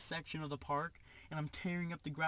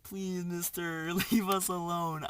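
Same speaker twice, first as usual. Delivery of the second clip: slow, drawn-out plea breaking into laughter; hammed-up begging, higher and louder toward the end; mock-scared begging; 3 semitones higher, 5 dB louder, slower than usual. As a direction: beg theatrically while laughing. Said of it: "I was so scared".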